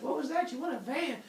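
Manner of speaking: high mock holler; yelling back. Zeus yells back at runner, high mock holler. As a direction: call out high, mishearing from afar. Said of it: "they're yelling back and forth".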